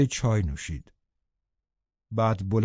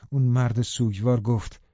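ordinary delivery, nothing marked

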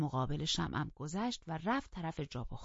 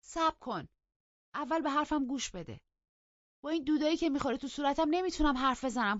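raised, high-pitched demand; loud, high insistence, speeding up; 10 semitones higher, 6 dB louder than usual. A narrator's reading. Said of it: "با صدای بلند گفت".

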